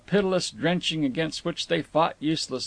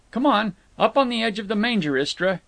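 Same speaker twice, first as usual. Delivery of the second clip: raised pitch, brisk command; ordering; 6 semitones higher, 3 dB louder than usual. A narrator's reading. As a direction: order her up onto it. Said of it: "he ordered".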